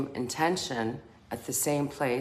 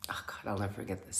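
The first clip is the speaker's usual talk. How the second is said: unvoiced aside whisper; 5 semitones lower, 9 dB quieter, faster than usual. Real, not acted. Whispering to the viewer, a hushed aside. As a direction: breathe it as a private aside.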